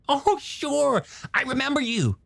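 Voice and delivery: high, animated character voice; eager younger brother. Acted. Hooper greeting his brother's old girlfriend, high eager character voice. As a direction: play a keen, friendly scientist, pitched well up.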